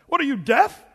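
loud, high, scornful bark at a student; angry professor. Retold or imitated. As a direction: yell it like a furious professor.

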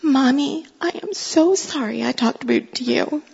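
put-on tearful toddler apology. Crying toddler saying sorry to her mother, tearful. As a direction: imitate a small child apologizing through tears. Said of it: "she totally started crying".

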